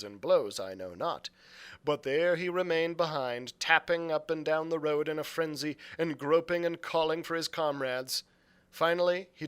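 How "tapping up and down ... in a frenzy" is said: usual speaking voice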